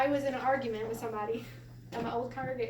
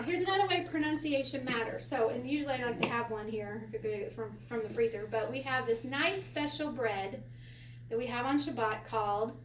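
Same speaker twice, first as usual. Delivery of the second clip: normal talking voice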